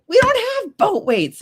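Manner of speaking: high, loud, drawn-out retort; exasperated protest. Retold or imitated. Teammate answering the boat weights idea, exasperated.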